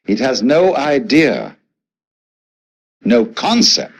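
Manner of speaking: slow, with weight on "idea" and "concept"; stress on idea, concept. Real, not acted. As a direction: set "idea" and "concept" apart with pauses, each emphasized. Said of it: "I emphasize the word idea and concept".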